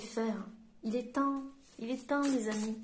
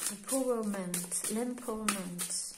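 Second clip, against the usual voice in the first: French-accented English; 4 dB quieter than usual. Real, not acted. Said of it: "mon accent anglais est pas terrible".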